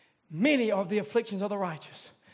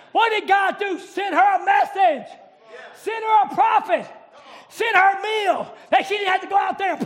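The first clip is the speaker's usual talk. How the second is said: preaching at full force; 7 semitones higher, 5 dB louder than usual. Acted.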